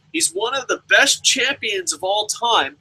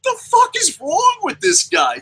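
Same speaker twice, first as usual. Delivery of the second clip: mock exasperated shout; 10 semitones higher than usual. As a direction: shout your disbelief at the crazy guy. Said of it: "I'm just like".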